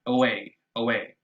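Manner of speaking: away with a clipped, weak first vowel; short schwa. Shown as a demonstration. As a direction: make the first sound of away tiny and quick. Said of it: "a much shorter sound".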